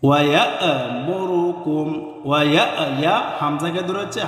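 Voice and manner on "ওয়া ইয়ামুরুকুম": slow ইয়া'মুরুকুম with a hard glottal hamza; firm hamza. Shown as a demonstration. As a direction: stop firmly on the hamza in ইয়া'মুরুকুম. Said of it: "হামজা শক্ত করে উচ্চারণ হবে". (ইয়া'মুরুকুম শব্দে হামজা শক্ত করে ধীরে)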